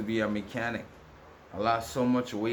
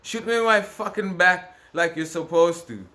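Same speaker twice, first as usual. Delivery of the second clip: shouted defiance, high and loud; angry retort; 8 semitones higher, 6 dB louder than usual. Retold or imitated. To the man who threatened him, a loud defiant shout. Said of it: "I'm like".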